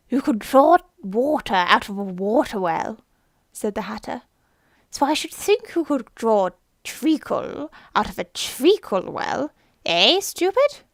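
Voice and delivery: loud, scornful, rising to a jeer; mocking Hatter. Hatter mocking Alice as stupid, loud and scornful.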